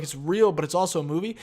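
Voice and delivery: ordinary talk, nothing marked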